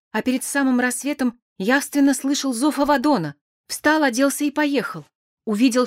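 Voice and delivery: acted voice of a shaken witness; Petya, distraught and tearful. Narrator voices Petya's account.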